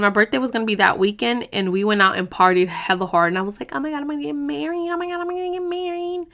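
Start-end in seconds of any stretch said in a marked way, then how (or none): 3.7-6.3 high, fast giddy gushing; higher and faster, breathless with joy; giddy squeal. Retold or imitated. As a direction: squeal with excitement about marrying. Giddy about upcoming wedding.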